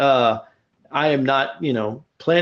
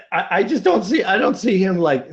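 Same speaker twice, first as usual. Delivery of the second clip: ordinary talk, nothing marked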